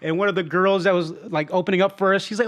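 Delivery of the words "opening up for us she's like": ordinary delivery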